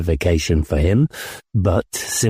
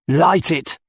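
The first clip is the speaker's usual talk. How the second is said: put-on drunk commander; 9 semitones higher than usual. A narrator's reading. Drunk commander gives order.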